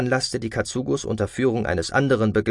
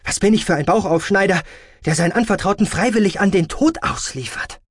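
raised, high-pitched protest from a proud surgeon; indignant doctor; 9 semitones higher, 4 dB louder than usual. A narrator's reading. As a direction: protest hotly, voice well up. (empörter Arzt, mit erhobener Stimme)

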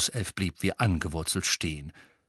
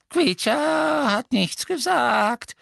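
high, slow, drawn-out denial; servile house-elf; 4 dB louder, slower than usual. Acted. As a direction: stretch each word in a servile elf voice. Elf denies his insult. (unterwürfiger Hauself, hoch und gedehnt)

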